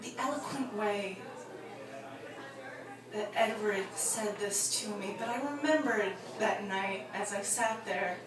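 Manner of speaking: wrapping the story up gently; quiet reflective close. Acted. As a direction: settle into the sad moral.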